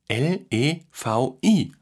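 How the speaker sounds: spelling out. A narrator's reading. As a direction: spell each letter slowly and separately. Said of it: "buchstabierte er". (langsam Buchstabe für Buchstabe)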